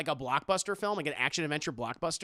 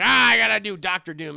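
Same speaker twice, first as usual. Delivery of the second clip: loud whiny complaint; complaining; 5 semitones higher, 12 dB louder than usual. Retold or imitated. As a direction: whine about it loudly. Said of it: "he's, like, complaining".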